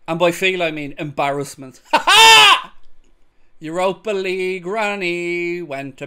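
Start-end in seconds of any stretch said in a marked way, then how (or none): 1.9-3.0 high, loud burst of laughter; gleeful shrieking laugh; loud cackle. Real, not acted. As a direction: cackle at the embarrassment. Laughing at United embarrassment.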